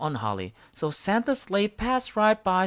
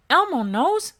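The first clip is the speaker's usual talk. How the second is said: put-on much higher, bright childlike voice; Elmo voice; 13 semitones higher, 5 dB louder than usual. A narrator's reading. Elmo answering Smudge, high and perky. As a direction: chirp it like a cheerful little monster. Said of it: "said Elmo".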